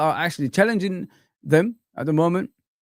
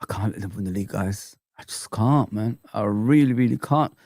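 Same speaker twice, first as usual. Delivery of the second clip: despairing; 8 semitones lower than usual. Real, not acted. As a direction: sound beaten down. Despairing rival fan.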